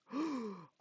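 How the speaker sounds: high, theatrical gasp; mock gasp. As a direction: gasp in feigned shock. Mock shock at the scan.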